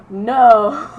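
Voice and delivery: gasp, then a loud, high "no"; mock shock. Student hearing the record says female, mock shocked. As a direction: play up the shock after a gasp. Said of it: "I was all shocked".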